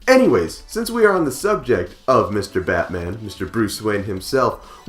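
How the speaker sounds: ordinary talk, nothing marked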